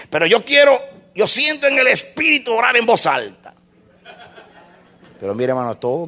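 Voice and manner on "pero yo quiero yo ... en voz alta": insistent sister. Retold or imitated. Sister insists on praying aloud.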